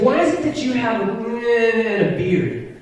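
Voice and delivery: put-on high kid voice, middle words mashed; high-pitched mock kid mumble; kid's garbled question. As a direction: imitate a kid rushing in, then dragging out the end. Repeats kid's unintelligible question.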